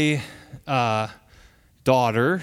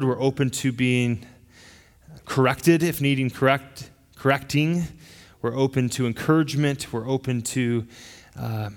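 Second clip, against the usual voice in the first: prayer; 5 semitones lower than usual. Real, not acted. Pastor opens with prayer.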